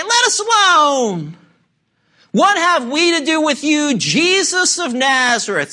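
loud, high, slow demonic cry. Acted. Unclean spirit crying out at Jesus, loud high slow cry. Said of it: "he cried out".

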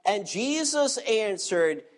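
usual speaking voice

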